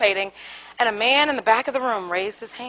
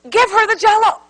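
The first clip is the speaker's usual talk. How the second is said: insistent demand; 7 semitones higher, 5 dB louder than usual. Retold or imitated. Argument between spouses.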